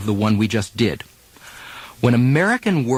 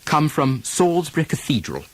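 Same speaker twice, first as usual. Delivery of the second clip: British RP model sentences, -ary vowels dropped. Shown as a demonstration. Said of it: "Try it in these sentences".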